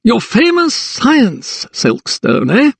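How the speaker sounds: high, slow, mocking magistrate; tight smile. A narrator's reading. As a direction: needle him with a tight smile. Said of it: "gave a tight smile".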